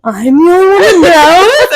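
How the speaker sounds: mock awe, loud and stretched; imitated amazed realisation. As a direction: swoop up in pitch, thrilled by a secret. Friend's amazed reaction, after gasp.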